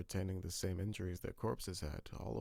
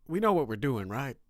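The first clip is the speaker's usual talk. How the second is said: nervous psyched-up question; 7 semitones higher, 12 dB louder than usual. Acted. Partners psyching up before raid.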